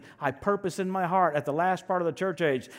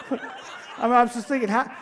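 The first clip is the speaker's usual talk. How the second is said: laughing; 7 semitones higher, 4 dB louder than usual. Real, not acted. Laughing at the possessed woman.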